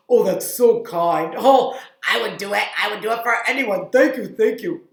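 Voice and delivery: loud, slowed cartoon gratitude; grateful puppet chef. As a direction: gush thanks in a puppet voice. Puppet chef thanks boy.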